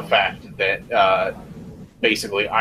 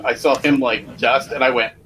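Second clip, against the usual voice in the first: ordinary talk, nothing marked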